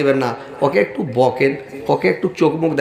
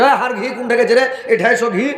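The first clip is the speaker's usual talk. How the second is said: higher, laughing call for the wife; calling out by pet name; 7 semitones higher than usual. Retold or imitated.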